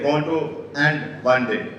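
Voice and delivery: ordinary delivery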